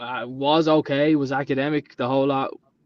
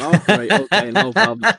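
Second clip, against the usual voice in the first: laughing through an easygoing okay; laughing agreement; 4 semitones higher, 5 dB louder than usual. Retold or imitated. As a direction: go along with it while laughing. Replying to boss's saying, laughing.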